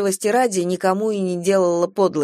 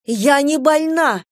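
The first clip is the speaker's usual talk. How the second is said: high, indignant outburst; high-pitched hysterical denial; hysterical cry; 7 semitones higher, 4 dB louder, slower than usual. A narrator's reading. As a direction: cry out in wounded protest. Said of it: "истерично выкрикнула Кира".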